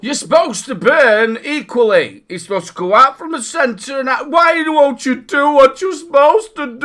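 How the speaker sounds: hammed-up high, loud wailing; frustrated rant. Builder berating misbehaving coils, mock-exasperated screaming plea. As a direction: scream it in mock despair at the stubborn thing. Acts out old coil-building rage.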